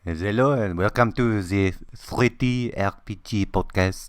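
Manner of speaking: a full mock French accent. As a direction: show intro in a thick French accent, "ze three T R P G". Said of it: "That's actually not bad".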